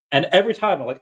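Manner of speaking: ordinary talk, nothing marked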